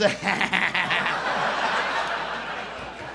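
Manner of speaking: low, long imitated laugh; rhythmic mock laugh. Audience laughing at rhythm alone, imitated low laugh. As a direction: laugh mechanically in a steady rhythm. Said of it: "just laughing at rhythm and shit".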